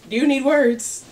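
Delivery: rising sass on "you"; sassy question. Pretend. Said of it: "repeating line, different reads".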